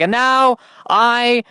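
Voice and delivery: high, slow grand incantation; wizard casting spell. Pretend. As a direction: intone it like a wizard. Mock grand magic spell.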